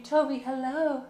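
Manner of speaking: high, cooing pet-owner voice; sweet high singsong invitation; pet talk. Real, not acted. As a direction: coax a beloved pet with lifted pitch. Coaxing pet onto lap.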